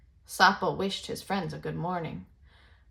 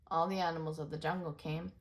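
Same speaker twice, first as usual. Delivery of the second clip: usual speaking voice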